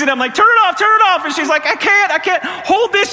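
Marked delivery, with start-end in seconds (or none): 0.3-1.2 high, loud frantic command; panicked yell. Retold or imitated. Dad to daughter as hose sprays, acted panicked yell. 1.7-3.1 high, slow strained wail; panicked daughter. Retold or imitated. Daughter struggling with gushing hose, mock panicked cry.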